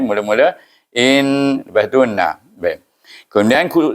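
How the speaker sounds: ordinary talk, nothing marked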